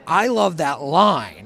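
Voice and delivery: slow, loud, emphatic enthusiasm; gushing fan. Retold or imitated. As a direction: draw it out as a delighted fan. Fan's gushing reply to idol.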